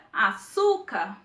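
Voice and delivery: "açúcar" slowly, leaning on "çú"; stress on middle syllable. Shown as a demonstration. Teacher giving a paroxytone example, "açúcar" stressed on "çú". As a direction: stretch the "çú" in "açúcar". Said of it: "A sílaba mais forte é su".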